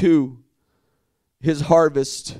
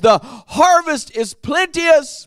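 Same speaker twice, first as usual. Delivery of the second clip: slow, higher plea of the harvest line; burdened Jesus; 5 semitones higher than usual. Acted. Preacher voicing Jesus to his disciples, burdened. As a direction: say it with a passion, not calmly. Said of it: "I don't think he said it calmly".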